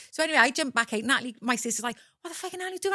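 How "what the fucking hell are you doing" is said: high, softer mock outrage; incredulous protest. Woman imitating her sister as she jumps out, high incredulous protest.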